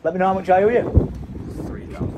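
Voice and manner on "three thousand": ordinary delivery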